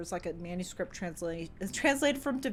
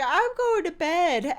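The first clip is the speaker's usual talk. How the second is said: high, slow mock announcement of going to bed; a lightly hammed-up roommate signing off, higher and louder; slow, lifted mock line from the roommate; imitating the roommate; 10 semitones higher, 8 dB louder, slower than usual. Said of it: "And she was like".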